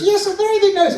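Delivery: high squeaky puppet voice; Minnie Paul. Acted. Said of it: "And you've got a big nose".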